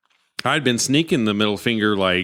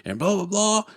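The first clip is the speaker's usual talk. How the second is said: higher, hammed-up wrestling hype voice; mock ring announcer; 8 semitones higher than usual. Retelling the announcer teasing Stone Cold, mock hype. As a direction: lightly parody the big introduction. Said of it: "He's like".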